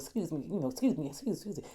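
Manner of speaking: soft, low, murmured excuse-mes; quiet repeated asides in passing; politely excusing herself, imitated. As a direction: lightly mimic someone slipping past people. Imitating grandmother excusing herself to shoppers.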